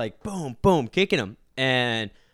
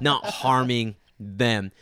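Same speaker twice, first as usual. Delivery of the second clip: usual speaking voice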